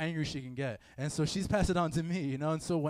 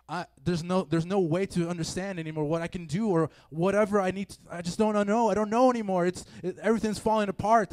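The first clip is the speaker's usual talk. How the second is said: rising, louder hopeless wail, voice straining; despairing cry; 3 semitones higher, 6 dB louder than usual. Acted. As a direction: cry out like everything is collapsing. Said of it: "That's what I feel".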